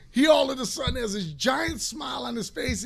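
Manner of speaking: ordinary talk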